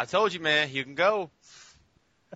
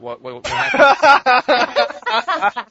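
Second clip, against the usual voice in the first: laughing disbelief; 10 semitones higher, 13 dB louder, much slower than usual. Real, not acted. Missed the conversation, laughing.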